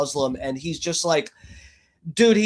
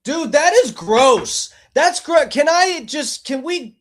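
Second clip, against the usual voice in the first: loud, higher-pitched disgusted exclamation; disgusted outburst; 8 semitones higher, 5 dB louder than usual. Real, not acted. Streamer reacting to a creepy text message, disgusted outburst. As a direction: blurt it out, openly grossed out. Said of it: "I'm disgusted".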